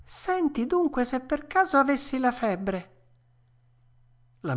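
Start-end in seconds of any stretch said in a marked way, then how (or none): 0.0-2.9 put-on high puppet-boy voice; a worried child asking to be examined; worried Pinocchio. A narrator's reading. Pinocchio asking the dormouse for help, high and worried.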